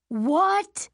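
loud, high shout of disbelief; outraged, shocked outcry; scream. A narrator's reading. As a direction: yelp it with a sharp rise. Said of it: "Bosch screams".

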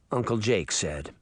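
ordinary delivery, nothing marked

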